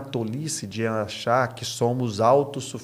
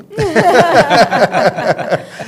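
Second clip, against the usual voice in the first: loud laugh; 11 semitones higher, 13 dB louder than usual. Real, not acted. Hearty laugh after joke.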